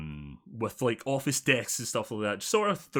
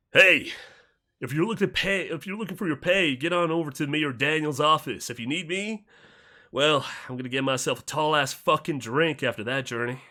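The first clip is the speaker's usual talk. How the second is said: wastelander voice; 5 semitones higher, 5 dB louder than usual. Acted. GM voices arriving wastelander NPC.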